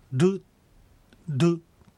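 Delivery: ル tapped off the palate, sounding close to "du"; isolated old-fashioned ル, nearly ドゥ; flicked old-style R. Shown as a demonstration. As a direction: flick the tongue for a D-like ル. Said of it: "弾くように発音する".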